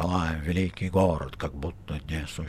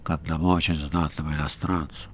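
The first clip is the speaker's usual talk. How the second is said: весомо, чужим голосом, с прищуром; произнеси многозначительно; значительно; 3 semitones lower than usual. Acted. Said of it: "значительно сказал рыжий". (рыжий значительно)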